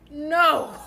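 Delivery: sharp loud no; emphatic no. Real, not acted. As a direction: shut it down firmly. Emphatic refusal in advice rant.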